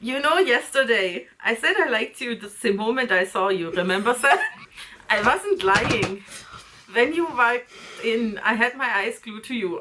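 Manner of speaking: hammed-up German accent for a dramatic reading. Co-host reads A J's confession to her, bad German accent. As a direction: read it as a badly faked German suitor. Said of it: "give him a German accent".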